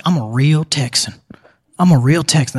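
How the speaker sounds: a lightly put-on proud Texan voice; mock Texan. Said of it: "Everyone's like".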